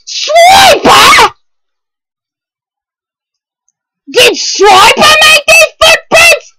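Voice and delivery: put-on screaming each line high and loud, like a panicked kid; frantic shout. Reading Dora aloud to viewers, hammed-up frantic shouting. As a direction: shriek the Dora book in mock panic.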